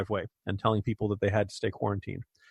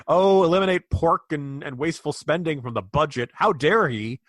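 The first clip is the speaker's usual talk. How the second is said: mock outrage; 5 semitones higher, 5 dB louder than usual. Mocking the snarky New York Times.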